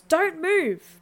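loud, high alarmed shout; yelling. Acted. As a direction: yell a sharp warning. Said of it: "The officer yells".

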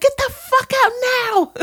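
hammed-up furious yell, way up high; screeching rant. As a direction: shriek her out of the gallery. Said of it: "And she's like".

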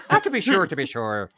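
high, loud start, hammed-up stage Irish; mock Irish accent. Irish speaker mocks outsiders' attempts, hammed-up Irish accent. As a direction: mimic people badly faking Irish, amused. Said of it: "trying to put on an Irish accent".

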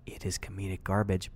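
hushed whisper; whispered aside. Real, not acted. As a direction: whisper it like a guilty secret. Aside about the show.

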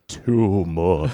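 slow, drawn-out horse voice; talking horse voice. Pretend. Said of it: "they all sound like this".

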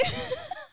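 a high squeal of laughter. Real, not acted. Wife laughing at her husband, high squealing laugh.

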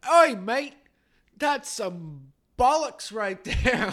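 loud, high mock Australian accent; hammed-up, drawn-out Australian outburst. Joke teller voices the doubting Australian passenger, mock Australian accent. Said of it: "And the Australian's like".

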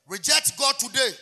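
slow, loud warning cry; fiery preaching. Acted. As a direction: hammer the warning home. Preacher warns the congregation.

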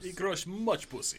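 put-on slow, broken Slavic accent; Eastern European accent. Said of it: "Slavic, Eastern European".

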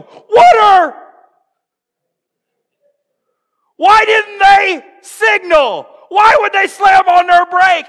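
high, loud exasperated yelling; road-rage shout. Acted. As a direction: yell in mock outrage at the driver ahead. Acting out angry driver's outburst.

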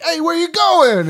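high, loud, drawn-out mock call; calling after her. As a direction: imitate a man shouting after someone leaving. Man calling after storming mistress.

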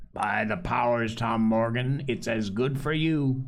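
ordinary delivery

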